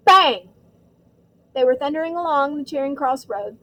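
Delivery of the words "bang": loud, sharp exclamation; sudden bang. A narrator's reading. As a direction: punch it like a bang.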